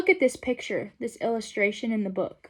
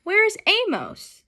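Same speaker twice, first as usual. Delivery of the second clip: wondering animals; 9 semitones higher, slower than usual. Acted. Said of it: "the animals wondered".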